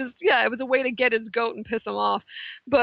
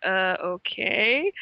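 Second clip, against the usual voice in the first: unsure; 4 semitones lower, much slower than usual. Retold or imitated. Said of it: "the look on her face was kinda like".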